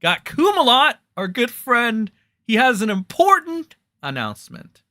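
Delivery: high, loud mock-announcer intro; mock presenter voice; sarcastic hype. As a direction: sell it with fake enthusiasm. Sarcastic big intro of Cody.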